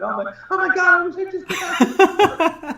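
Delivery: high, wailing mock panic, dragged out slow; stretched-out squeal of overwhelm; mock freakout. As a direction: ham up a desperate wail.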